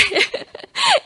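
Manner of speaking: high teary laugh breaking through; a real laugh that turns into crying; laughing through tears. Real, not acted. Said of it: "you make me cry".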